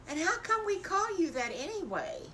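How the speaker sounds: a hint of inquisitive piglet; puzzled piggy. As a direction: ask with a child's puzzlement. Storyteller voices puzzled piglet asking.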